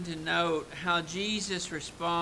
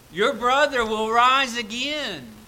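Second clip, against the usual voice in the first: proclaiming promise; 6 semitones higher, 11 dB louder than usual. Acted. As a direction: announce it with conviction. Jesus' promise quoted.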